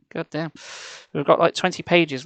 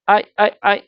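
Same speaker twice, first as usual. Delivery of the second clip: stammering; 4 semitones higher, 4 dB louder than usual. A narrator's reading. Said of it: "I stammered".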